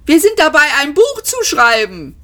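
panicked high-pitched shout; shouted protest. A narrator's reading. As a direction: cry out the excuse desperately. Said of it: "schrie Ford".

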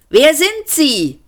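high, slow, stretched shout; called-out question. A narrator's reading. Seyfort questioning a far-off stranger, calling out. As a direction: holler the question over a distance. Shouted exchange across corridor.

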